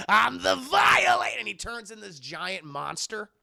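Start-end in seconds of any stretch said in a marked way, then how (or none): 0.1-1.4 loud, higher roar of his own name; hammed-up villain declaration shout; villain boast. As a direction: bellow it like a proud villain. Violator proclaims himself.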